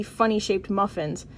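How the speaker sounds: ordinary delivery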